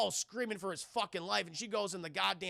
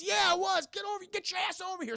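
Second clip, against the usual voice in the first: urgent shout; 12 semitones higher than usual. Retold or imitated. Chad urging the doctor.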